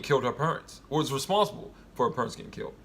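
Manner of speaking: ordinary delivery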